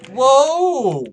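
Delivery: high, loud gasp of a whoa; stunned exclamation; shocked whoa. Real, not acted. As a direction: blurt it out in shock. Shocked at the firing story.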